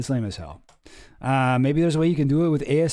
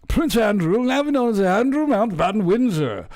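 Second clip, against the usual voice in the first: high pompous proclamation; mock royal announcement; 6 semitones higher than usual. Reading the statement to viewers, pompous mock herald.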